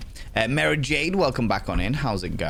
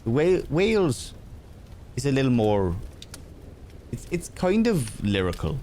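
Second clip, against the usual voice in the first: lilting, higher-pitched Welsh attempt; Welsh accent; 4 semitones higher than usual. Shown as a demonstration. Streamer who keeps slipping into Irish, attempted Welsh accent. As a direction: lyrical Welsh accent, feeling for it. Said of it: "when I do Welsh".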